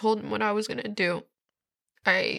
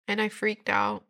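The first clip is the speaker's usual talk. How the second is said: ordinary talk, nothing marked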